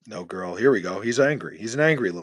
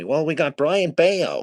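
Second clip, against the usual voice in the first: slow, higher mock whine of a smug fan; mocking a viewer; 6 semitones higher, 5 dB louder, slower than usual. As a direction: drag it out, mocking his brag. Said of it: "you told me".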